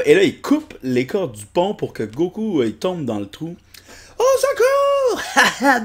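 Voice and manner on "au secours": high, slow, drawn-out wail; cartoon cry for help. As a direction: wail for help while falling. Personnage tombe dans le trou. (appel au secours aigu et traînant)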